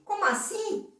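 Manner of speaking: put-on high-pitched surprised little boy; Armandinho. Boy questioning his father about the Olympics, high surprised voice. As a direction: squeak it out in puzzled surprise. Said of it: "o pobrezinho do Armandinho".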